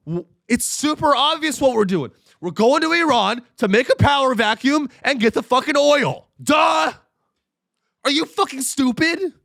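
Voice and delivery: high, loud blunt official blurting it out; mock Pete Hegseth. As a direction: yell it like a drunk defence boss. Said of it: "he goes".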